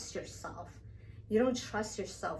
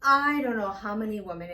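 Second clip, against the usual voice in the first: ordinary talk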